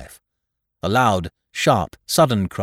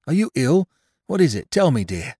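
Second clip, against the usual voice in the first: anxious husband; 7 semitones higher, 4 dB louder than usual. Acted. Husband anxious over wife.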